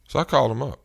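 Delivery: ordinary delivery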